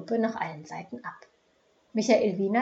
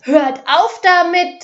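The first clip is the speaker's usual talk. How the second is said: high, loud, slowed shrill cry; 9 semitones higher, 15 dB louder, slower than usual. A narrator's reading. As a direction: shriek it over the heads of the crowd. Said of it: "schrillte Adelheids Stimme".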